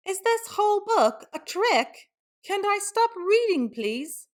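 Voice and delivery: high whiny plea; pleading whine. Acted. Reader begs to stop reading, high whine. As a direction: beg to be let off, high.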